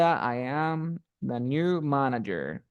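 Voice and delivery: slow, deliberate model sentence in English; slow formal example. Shown as a demonstration. Teacher dictating a formal written example slowly. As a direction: lay out each word slowly for the class. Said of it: "Por ejemplo, dirías".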